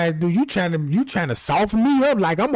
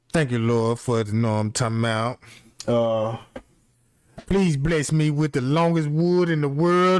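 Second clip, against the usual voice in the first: lower, hushed mock grace before a meal; mock prayer; 8 semitones lower than usual. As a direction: pray over it, lowered and solemn for laughs. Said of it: "got to pray".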